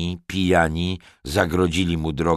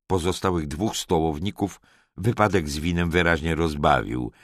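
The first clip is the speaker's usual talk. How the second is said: ordinary delivery, nothing marked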